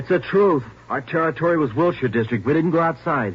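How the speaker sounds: loud, pleading denial; defensive protest. Acted. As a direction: push back hard against the cops. Suspect protesting under interrogation.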